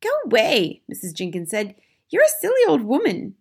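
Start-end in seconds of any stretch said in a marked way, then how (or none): 0.0-0.8 high, loud dismissal; indignant wife. A narrator's reading. Missus Jenkins dismisses grandmother, high and indignant. 2.1-3.4 high, loud scolding; indignant wife. A narrator's reading. Missus Jenkins insults grandmother, high and affronted.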